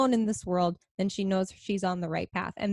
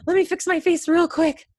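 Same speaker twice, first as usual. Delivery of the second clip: excited; 4 semitones higher, 4 dB louder than usual. Retold or imitated. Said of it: "And I'm like".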